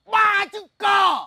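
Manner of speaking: stern elder hammering each word; slow shouted command. Acted. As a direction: bark it out slow and loud. Old man commands angrily. (quát chậm rãi, dằn từng chữ)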